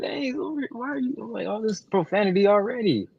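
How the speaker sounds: high, soft prissy scolding voice; a put-on voice chiding the swearing; mock scolding. Co-host mocking his own swearing, high and soft. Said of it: "I'm like".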